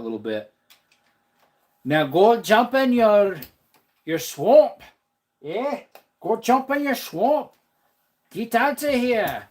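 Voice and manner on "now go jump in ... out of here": put-on high, loud Scottish-accented ogre voice; Shrek. Streamer shooing a viewer off to the swamp, loud high Scottish ogre impression. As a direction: push it up and out in a mock Scottish accent. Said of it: "He has a Scottish accent when he talks".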